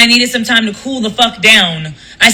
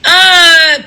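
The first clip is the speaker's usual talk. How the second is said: outraged shout; 6 semitones higher, 5 dB louder than usual. Real, not acted. Outraged reaction to the lie.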